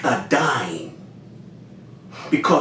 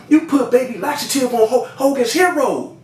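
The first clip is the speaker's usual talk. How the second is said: retold outrage; 10 semitones higher than usual. Confronting supplier about cutting the product, retold incredulous outrage. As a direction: stretch the last words high in disbelief.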